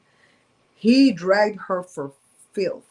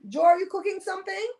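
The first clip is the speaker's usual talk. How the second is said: high, rising call across to someone; 14 semitones higher than usual. Real, not acted. Asking Joe once more about cooking, calling out. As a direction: repeat the question high, lifting the end.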